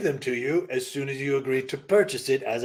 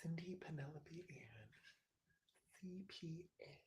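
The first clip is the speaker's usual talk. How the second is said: breathy whisper, no voice; 2 semitones higher, 27 dB quieter than usual. Acted. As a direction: whisper it like a secret. Whispered aside.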